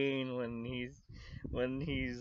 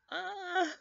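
anguished cry. Real, not acted. Reacting to the scene.

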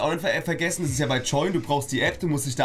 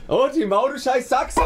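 playing Eli; 10 semitones higher, slower than usual. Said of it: "ich bin kurz Eli".

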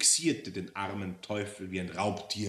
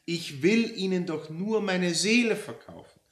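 high, loud, outraged complainant; aggrieved customer demanding her due; indignant Frau Roller; 11 semitones higher, 9 dB louder than usual. Acted. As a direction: build from pointed address to loud outrage.

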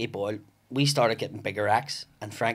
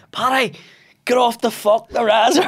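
high, loud heckler's yell; shouted heckle; 11 semitones higher, 11 dB louder than usual. Retold or imitated. Audience member heckling comic off stage, shouting. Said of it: "And somebody shouted".

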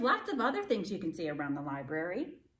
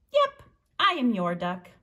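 lively read-aloud with high character voices; storytime reading; 8 semitones higher, 7 dB louder than usual. A narrator's reading. Librarian reading to children, animated character voices. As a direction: read the duck story to the kids, bouncing between voices. Reads picture book with voices.

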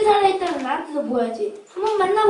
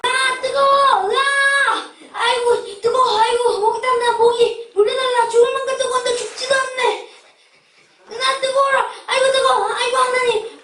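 higher pained wailing in torment; anguished cries; 4 semitones higher than usual. Acted. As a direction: cry out in burning agony. Rich man burning in hell.

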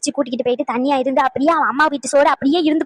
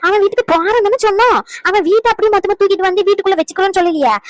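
indignant retort; 6 semitones higher than usual. Acted. Grandmother snaps back at granddaughter.

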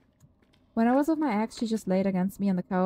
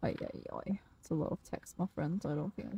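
whispered groan; 6 semitones lower, 11 dB quieter than usual. Real, not acted. Groaning at a wall of text.

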